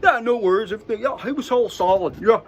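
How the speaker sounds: mock San Diego surfer accent. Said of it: "that's a San Diego, uh, accent right there".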